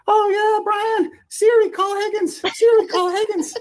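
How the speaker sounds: high falsetto moaning; high mock female moan; mock woman's moan. Falsetto woman calls Siri.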